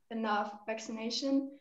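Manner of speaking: ordinary talk, nothing marked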